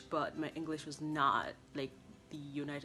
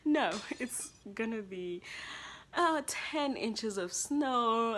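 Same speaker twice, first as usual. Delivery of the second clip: slow, louder, drawn-out warning; mom's reply; 6 semitones higher, 6 dB louder, slower than usual. Retold or imitated. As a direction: stretch out the bad news. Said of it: "My mom was like".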